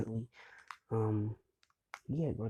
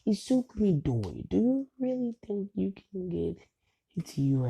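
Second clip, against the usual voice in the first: high, louder jeer of disbelief; mocking classmates; 8 semitones higher, 4 dB louder than usual. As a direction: taunt him in an incredulous high voice. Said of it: "And they start laughing".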